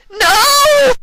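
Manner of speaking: shrieking very high, loud no; ear-splitting scream. Acted. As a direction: scream out of a nightmare. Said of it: "ear-splitting scream".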